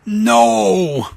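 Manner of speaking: a sharp, mild cry of protest; yelled no. Acted. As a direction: try to stop him with one word. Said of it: "Yelled Boogeyman".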